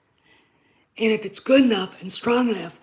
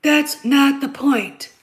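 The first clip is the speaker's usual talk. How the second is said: slow angry shout; 4 semitones higher, 7 dB louder, much slower than usual. Real, not acted. Shouting back at his listeners.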